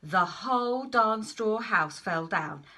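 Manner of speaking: wolf stressing the collapse; dramatic emphasis. Acted. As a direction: drag out the collapse for effect. Telling the house collapsing.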